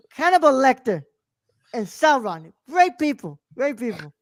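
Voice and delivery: hammy politician's stump-speech impression; Donald Trump. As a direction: boast in short repeated phrases, like a campaign rally. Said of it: "say this in the Trump voice".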